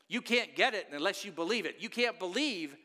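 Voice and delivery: ordinary delivery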